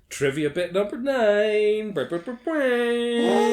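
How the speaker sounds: loud, high announcer with a buzzing jingle. Acted. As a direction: sing out a quiz jingle.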